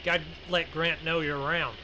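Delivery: usual speaking voice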